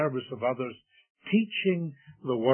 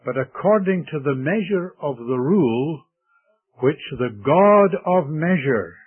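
louder, weighty verse reading; emphatic scripture reading; 7 dB louder than usual. Real, not acted. Preacher reading Darby's translation to the congregation, emphatically. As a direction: proclaim the text, driving home each key phrase.

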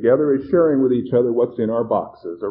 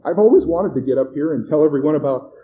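eager woman, higher and louder; excited; 5 semitones higher, 8 dB louder than usual. A narrator's reading. Plump woman finally getting to tell everyone, excited. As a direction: gush to the crowd, thrilled. Thrilled woman takes the stage.

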